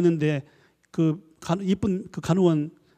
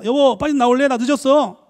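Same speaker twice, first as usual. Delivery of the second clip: loud, high, hurrying call through the door; impatient shout; 7 semitones higher, 6 dB louder than usual. Acted. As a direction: yell at the husband to hurry. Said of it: "남편이 화장실 안 했습니다". (화장실 앞에서 남편에게 재촉하며 높고 크게 외치는 아내)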